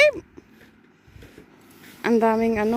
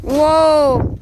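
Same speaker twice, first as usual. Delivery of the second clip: shouting over wind; 7 dB louder than usual. Real, not acted. Windy snowstorm outside, calling out.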